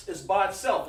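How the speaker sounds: normal talking voice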